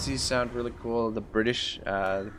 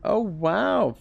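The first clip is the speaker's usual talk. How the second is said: surprised; 10 semitones higher, 4 dB louder than usual. Real, not acted. [name] ends pact in game.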